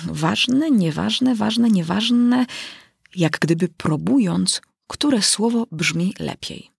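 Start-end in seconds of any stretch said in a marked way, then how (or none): none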